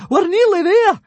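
high, loud shout; yelling. Acted. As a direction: keep yelling with hope. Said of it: "yelled Fishlegs".

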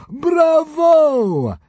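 high loud shout. A narrator's reading. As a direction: shout it in delight. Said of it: "shouted the fat consul".

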